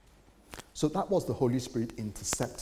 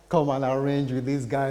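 loud, commanding pastor; bossy order; 4 semitones higher, 9 dB louder than usual. Retold or imitated. Pastor ordering helpers around, loud and commanding. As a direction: bark it out like the man in charge.

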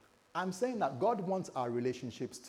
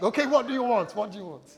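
higher, louder giving-in voice; melting husband; 7 semitones higher, 7 dB louder than usual. Retold or imitated. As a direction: cave in like a smitten husband. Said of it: "it just weakens me. I just become like".

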